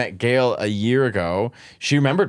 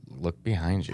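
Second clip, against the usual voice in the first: retold hushed warning; 6 semitones lower, 8 dB quieter than usual. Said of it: "in Italian, he was like".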